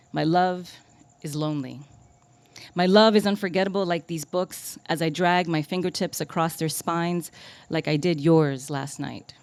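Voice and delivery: emphatic spoken-word cadence with refrain; poetry reading. Acted. Poet dedicating a love sonnet to herself, emphatic recital. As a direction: hit each opening phrase, then ease down.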